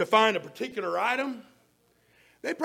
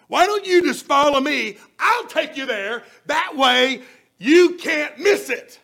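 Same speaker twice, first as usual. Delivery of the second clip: louder, higher, eager and insistent; eager offer; 6 semitones higher, 11 dB louder than usual. Acted. A helper eagerly offering to lead a lost stranger, loud and bright. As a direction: call it out like you really mean to lead them.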